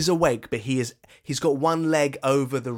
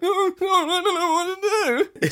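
much higher, laughing mock panic; 4 dB louder than usual. Host acting Checo's overwhelmed brain in mock panic.

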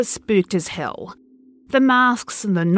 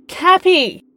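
loud, much higher call across town; shout; 9 semitones higher, 5 dB louder than usual. Acted. Man calls out for his missing friend Cappy, loud shout. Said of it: "I shouted".

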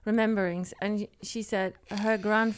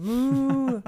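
long, loud moo, pitched up; cow moo; 4 semitones higher, 6 dB louder than usual. Retold or imitated. Mocking how Danish "mor" sounds, cow moo. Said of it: "sound like the cow mowing".

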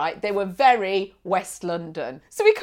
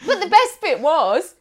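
ordinary talk

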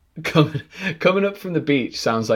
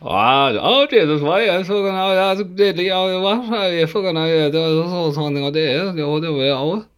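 a hammed-up Kerry Irish accent, higher; 8 semitones higher, 3 dB louder than usual. Asked for his Kerry accent, he launches in. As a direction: swear through it like a Kerry local. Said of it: "How's your Kerry accent".

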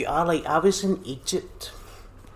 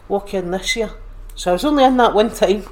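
much louder, higher and fast; excited and loud; 7 semitones higher, 10 dB louder than usual. Real, not acted. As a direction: rush it out loud and high, caught up in the story. Excited retelling of pub visit.

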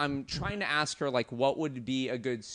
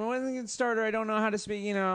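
higher, pleading tourist voice; asking the waitress; 8 semitones higher than usual. Retold or imitated. Tourist asking a Japanese waitress for a starter, pleading. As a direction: play the lost tourist asking for help. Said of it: "I'm saying".

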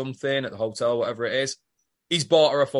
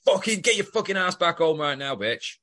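high-pitched opening, retold angry order; hammed-up furious dad, falling at the end; mock furious; 6 semitones higher than usual. Joe orders her back home, mock-furious. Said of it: "Joe's fucking furious".

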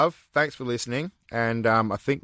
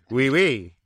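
mock French; 3 semitones higher, 4 dB louder than usual.

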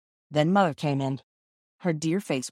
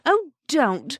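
high pleading cry; cried out; 9 semitones higher, 5 dB louder than usual. A narrator's reading. Sister begs her brothers and sisters to stop, high cry. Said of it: "cried Roberta".

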